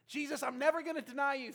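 loud, high vow of loyalty; impassioned mock-up of a bold pledge; fervent promise. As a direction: swear it with full zeal. Replays Peter's bold promise.

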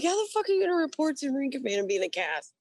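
high, fast mock outrage; retold incredulous demand. Superiors questioning a Marine who arrived in a cast, retold incredulous. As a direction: rattle it off high-pitched, imitating their disbelief.

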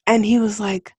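ordinary delivery

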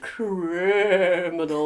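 slow, deep horror-host drawl; spooky drawn-out voice. Acted. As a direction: stretch it out like a ghost story. Spooky dramatic reading of clue.

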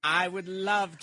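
slow, strange Mars Attacks alien. Pretend. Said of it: "You sounded like the aliens from Mars Attacks".

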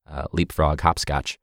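ordinary talk, nothing marked

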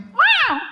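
very high squeaked mock roar; little cub's growl. Cub roaring again at the wolf, squeaky growl. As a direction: roar like a tiny bear cub, high-pitched. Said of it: "And so he went".

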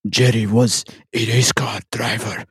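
gruff fake soldier voice, half whispered; fake grizzled soldier voice. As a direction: ham up a macho war-hero growl. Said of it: "fake, like, grizzled, like, soldier voice".

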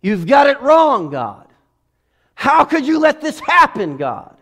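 mock arguing with God. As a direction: shout your complaint up at God. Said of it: "arguing with God".

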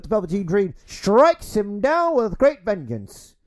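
rising to a loud, high race-call finish; horse race commentator. Pretend. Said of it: "you totally sounded like a, a, a horse race commentator".

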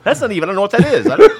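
fast, rising talk through laughter; real laughter taking over the words; laughing. Real, not acted. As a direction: blurt it out while cracking up. Cracking up over accent.

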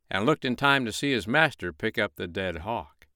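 ordinary delivery

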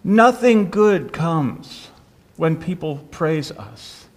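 very slow, weighty, the first words leaned on; preacher repeating the lesson slowly; solemn emphasis. Shown as a demonstration. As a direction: drag it out and let it sink in. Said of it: "repeats key line".

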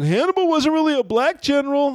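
high-pitched mock protest; put-on indignant squeak; mocked objection. Mocking angry objectors' claim.

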